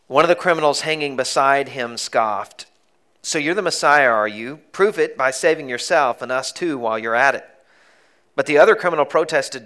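reading scripture aloud with force. Acted. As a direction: read the passage out to the congregation. Preacher reads the scripture aloud.

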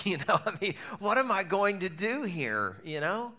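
amused, half-laughing despair. Real, not acted. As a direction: laugh through the helplessness. Laughing at his own despair.

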